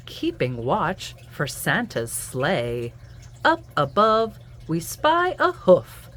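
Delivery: lively sing-song picture-book read-aloud; reading to children. A narrator's reading. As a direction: read it to little ones at bedtime.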